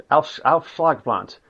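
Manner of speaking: usual speaking voice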